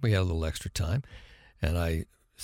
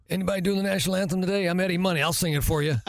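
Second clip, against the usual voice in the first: a touch of an excited call, higher; 8 semitones higher, faster than usual. Retold or imitated. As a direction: lightly voice a rocker shouting from the stands. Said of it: "and he goes".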